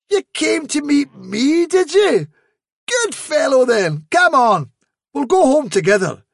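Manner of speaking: high, hearty voice for the kindly master pleased with his dog; cheerful master. A narrator's reading.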